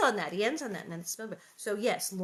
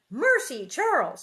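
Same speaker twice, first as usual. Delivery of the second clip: raised, louder startled exclamation; shocked gasp; 5 semitones higher, 9 dB louder than usual. A narrator's reading. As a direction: exclaim it, scandalised at a husband. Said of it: "Ma gasped".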